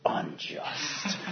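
whispered, outraged "unjust"; a toddler's indignant whisper; sulky whisper. Retold or imitated. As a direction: hiss it like a sulking child. Tantrum voice drops to hiss.

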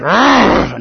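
loud, high exasperated "argh"; mock freak-out growl. Acting out freaking out, over the top.

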